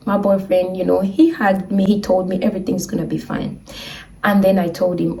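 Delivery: plain reading voice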